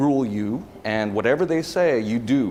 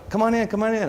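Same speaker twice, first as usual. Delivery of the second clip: cheerful welcome; 11 semitones higher, faster than usual. Retold or imitated. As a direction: imitate the bar owner waving you in happily. Bar staff welcoming him back.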